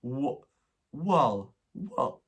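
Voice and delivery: mock posh "well" stretched towards "wall"; a drifty, distant posh "Wow... well, well"; posh English "well". Scottish vlogger imitating her flatmate's posh "well". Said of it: "She would say".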